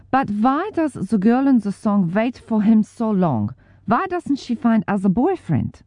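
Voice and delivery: a hint of a puzzled German man; German accent. Willie asking about the sad song, light German accent. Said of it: "Willie asking something like".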